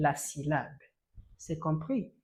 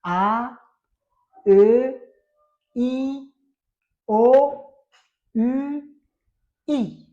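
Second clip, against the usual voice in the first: loud vowel drill; 6 semitones higher, 12 dB louder, much slower than usual. Shown as a demonstration. Teacher drills the vowels for students, loud and clear. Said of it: "Il y a la voyelle".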